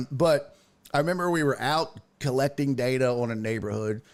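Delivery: ordinary delivery, nothing marked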